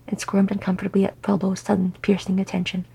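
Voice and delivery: ordinary delivery, nothing marked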